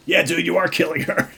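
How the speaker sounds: high, loud, incredulous aside; exclaimed mocking retort. Real, not acted. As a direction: burst out at the clueless character. Teller's mocking retort to the character.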